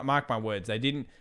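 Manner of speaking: usual speaking voice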